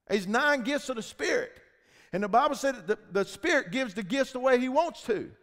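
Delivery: loud, high, driving preacher's voice; fiery preaching. Acted. Preacher firing up the congregation, loud and driving. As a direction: preach it out full force to the church.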